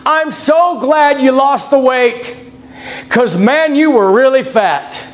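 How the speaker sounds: tactless doctor. Retold or imitated. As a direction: imitate a doctor congratulating tactlessly. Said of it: "I'm glad he said it differently".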